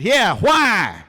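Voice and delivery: a loud, high "Yeah, why?" in a West Texas twang; mimicking the stranger's West Texas speech; West Texas accent. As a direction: answer back in the local West Texas way. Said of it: "Wanted to let him know I spoke the way he did".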